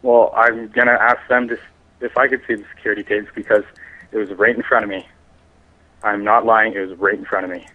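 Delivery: ordinary delivery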